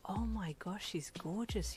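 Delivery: hushed, amazed aside; awed thought. A narrator's reading. Listener privately marvels at the beautiful hairdresser, hushed awe. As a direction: think it softly, wonderstruck.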